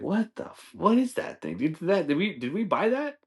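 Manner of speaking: high-pitched incredulous questions; mock disbelief. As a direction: stumble over it, baffled. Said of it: "I'd be so fucking pissed".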